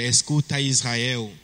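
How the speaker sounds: ordinary talk, nothing marked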